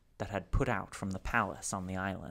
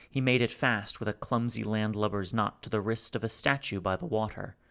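ordinary delivery

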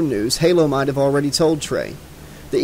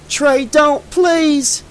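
high, urgent shout; calling out high, begging; pleading yell; 12 semitones higher, 4 dB louder, much slower than usual. A narrator's reading. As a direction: yell a desperate plea to stop. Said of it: "yelled Halo".

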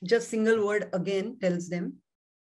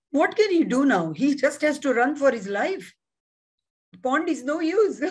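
higher-pitched imitation of a stuck student; puzzled student; 5 semitones higher, 4 dB louder than usual. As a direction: voice a stumped pupil, pitched up and lively. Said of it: "My student got stuck on this".

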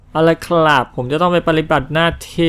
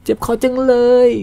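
much higher, put-on whine about a sore throat; 10 semitones higher than usual. Streamer playfully moaning about his sore throat, in a high put-on voice. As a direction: jump up into a silly high complaint. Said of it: "เวลาใช้แบบพูดเสียงสูง".

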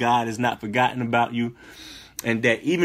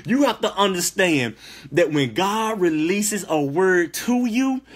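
ordinary talk, nothing marked

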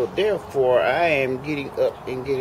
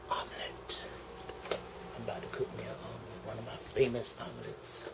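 hushed mutter; 16 dB quieter than usual. Real, not acted. Muttering to himself while cooking.

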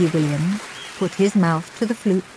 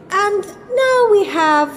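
slow, high-pitched introduction; announcing headmistress; 14 semitones higher, 3 dB louder, slower than usual. A narrator's reading. Missus Oddbod introducing the next act, announcing.

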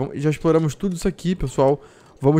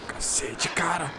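breathy whispered curse; whispering while hiding; 3 semitones higher, 9 dB quieter, much slower than usual. Real, not acted. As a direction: whisper it so nothing hears. Hiding from the wolf.

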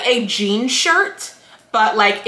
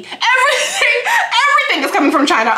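laughing outburst; 13 semitones higher, much faster than usual. Real, not acted. Laughing outburst about Walmart purse.

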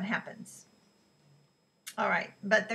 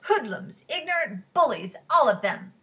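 put-on loud, higher old woman tirade; loud angry; 4 semitones higher, 8 dB louder than usual. A narrator's reading. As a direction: hurl the insults out loud. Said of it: "Miriam said loudly".

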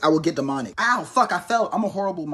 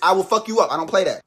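ordinary delivery

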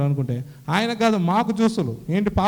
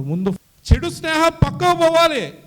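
much higher and louder, pressing hard; urgent insisting; 9 semitones higher, 7 dB louder than usual. Retold or imitated. Brother urging him to drop bad friends, in a loud insistent voice. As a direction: push the point home.

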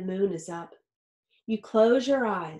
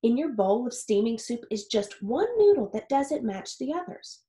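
usual speaking voice

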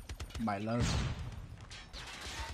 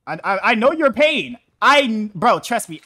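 shouted reassurance, much louder and higher; loud consoling; 10 semitones higher, 13 dB louder than usual. Real, not acted. Streamer consoling a struggling player, loud and high. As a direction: yell your sympathy across the game.

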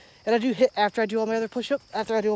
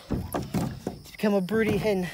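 ordinary talk

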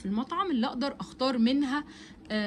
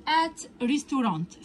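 slow model reading; much slower than usual. Shown as a demonstration. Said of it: "teacher models English slowly".

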